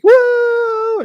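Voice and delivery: high whooping cry of thrill; wife's whoop. Retold or imitated. Wife whooping as she zips off. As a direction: let out a high joyful woo.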